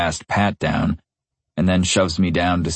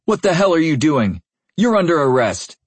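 panicked shout; 10 semitones higher than usual. A narrator's reading. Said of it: "in a totally ridiculous panic".

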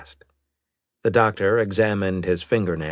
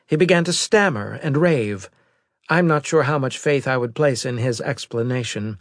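higher-pitched, louder officer's voice; intelligence officer; 9 semitones higher, 4 dB louder than usual. Acted. Officer briefing the admiral on a survivor, higher-pitched report voice. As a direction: deliver a formal report in a raised pitch.

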